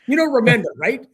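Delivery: high, loud retold complaint; pissed off. Fuming colleague complaining to his wife, pissed off. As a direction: imitate a colleague fuming down the phone. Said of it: "now he's pissed off".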